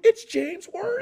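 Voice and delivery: very high, awestruck cartoon yelp; Scooby-Doo. Starstruck comic spotting the athlete, high cartoon voice. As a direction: squeal it like a startled cartoon. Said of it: "like in Scooby-Doo".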